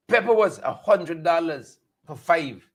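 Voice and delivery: normal talking voice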